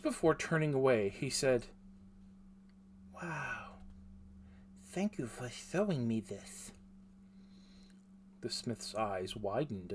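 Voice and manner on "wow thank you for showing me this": quiet, amazed and shy; soft awed boy voice. Acted. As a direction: breathe it out in wonder. Awed boy thanks smith softly.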